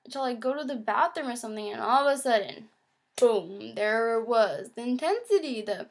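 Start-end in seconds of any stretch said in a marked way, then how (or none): none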